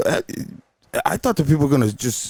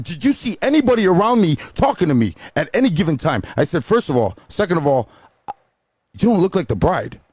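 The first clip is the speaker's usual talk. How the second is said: higher, challenging retold retort; indignant comeback; 7 semitones higher than usual. DJ talks back to the pushy guest, indignant. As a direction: count off the points in indignation.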